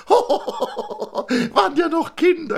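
high, slow, laughing fondly; a giggling thin man recalling school days. Acted. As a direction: chuckle through the words.

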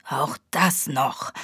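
slow, soft, low grumble under a cold; grumbled. A narrator's reading. Sniffling agent in the rain, slow grumble. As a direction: mutter it sourly, slow and quiet. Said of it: "brummte er".

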